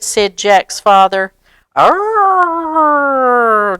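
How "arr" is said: high, loud whiny arr; whiny groan. Acted. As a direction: whine it out like a sulky kid. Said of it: "Jack whined".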